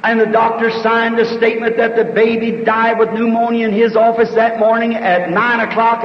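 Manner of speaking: normal talking voice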